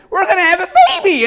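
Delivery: high, loud, fast burst of excitement; excited couple. Acted. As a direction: blurt it out like thrilled expectant parents. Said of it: "and they're all excited".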